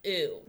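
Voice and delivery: "oo" said as a long oo; vowel sound. Shown as a demonstration. Teacher showing the tricky vowel, long "oo". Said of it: "it says".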